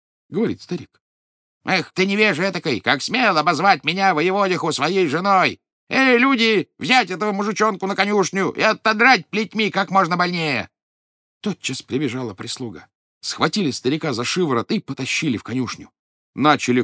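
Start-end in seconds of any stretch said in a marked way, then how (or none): 1.6-10.7 much higher, louder imperious shouting; haughty furious wife. Acted. Wife as governor shouts to flog her husband, imperious fury. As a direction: shout orders at the servants.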